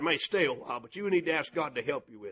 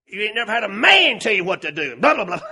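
loud, higher scolding; angry telling-off; 5 semitones higher, 10 dB louder than usual. Retold or imitated. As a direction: lay into her, voice raised. Preacher tells off the woman.

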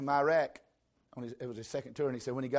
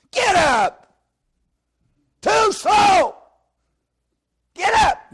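screaming high drill instructor; drill sergeant shout; 20 dB louder, much slower than usual. Retold or imitated. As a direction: scream orders at the private. Drill sergeant yelling at recruit.

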